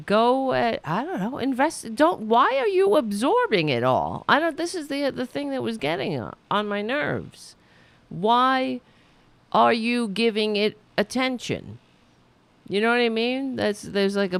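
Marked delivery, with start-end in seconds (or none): 1.9-4.2 high, loud incredulous question; exasperated challenge. Pretend. Host challenging a couple who absorb online abuse, exasperated. 8.1-11.8 exasperated. Pretend. Exasperated at absent couple.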